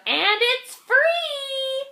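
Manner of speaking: high, slow, laughing bug voice. Acted. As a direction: giggle out the last words. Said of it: "laughed the bug".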